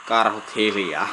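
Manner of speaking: slow, loud order to the bears; loud slow command. Real, not acted. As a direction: boom it out to hush them.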